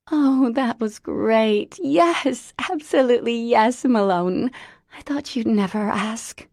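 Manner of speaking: high, loud joyful yes; tearful joy. A narrator's reading. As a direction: accept with teary delight. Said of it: "She half laughed, half wept".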